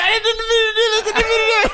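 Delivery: high, laughing panicked protest; panicked laughing plea. Retold or imitated. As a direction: squeal it out in mock panic. Panicked kid caught with mom.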